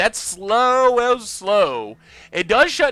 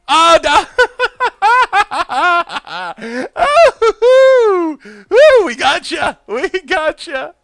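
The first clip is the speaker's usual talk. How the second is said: real ecstatic whoops and laughter, much higher and louder; cheering the win at the top of the voice; too excited; 11 semitones higher, 10 dB louder, slower than usual. Real, not acted. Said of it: "I'm too excited".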